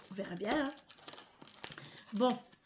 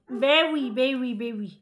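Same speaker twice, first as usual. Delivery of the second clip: talking to a pet; 7 dB louder, slower than usual. Real, not acted. Owner answers her grumbling dog, loud pet-talk. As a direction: answer the dog loudly, easing off.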